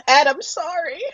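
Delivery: drooling while talking; mouth full of hot food. Real, not acted. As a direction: mouth watering from hot peppers. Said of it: "I'm just drooling so much".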